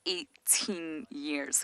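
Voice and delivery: EIGHTEEN YEARS stretched out very slowly; drawn-out amazement. Real, not acted. Guide marvelling at a tick's fast without food, slow emphasis. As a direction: let each word of Eighteen years sink in.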